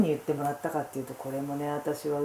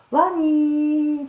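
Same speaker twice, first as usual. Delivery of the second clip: ワニー with a long, high, louder held "ee"; stretched final syllable; 10 semitones higher, 5 dB louder, much slower than usual. Shown as a demonstration. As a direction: sustain the end of ワニー loud and high. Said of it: "ちょっと二が伸ばすんですよ". (ワニーの「ニー」を高く長く伸ばす)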